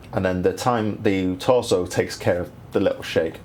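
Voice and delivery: ordinary talk, nothing marked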